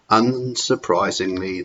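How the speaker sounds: usual speaking voice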